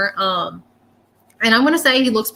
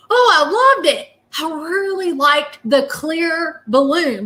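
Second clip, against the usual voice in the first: much higher, slow, delighted exclamation; delighted spirit; 7 semitones higher, slower than usual. Retold or imitated. Spirit delighted by the clear balloon, high and slow. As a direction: gush over the balloon like a happy child.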